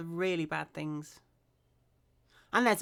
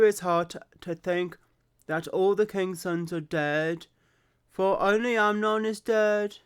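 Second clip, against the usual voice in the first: put-on Brummie accent; 7 dB louder than usual. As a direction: read Jonadab's lines in put-on Brummie. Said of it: "I'm doing the stereotypical Brummie accent".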